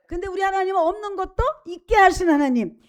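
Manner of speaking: loud, rising climax of the sermon; fervent preaching. Acted. Preacher to congregation about God's strength, fervent loud preaching. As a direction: preach it out with full force.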